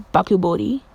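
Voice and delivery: "Bakubori" blurted out as the wrong name; slip of the tongue. Real, not acted. Reading the poll, the wrong name slips out. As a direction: say "Bakubori" by mistake, without noticing. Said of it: "did I just say Bakubori?".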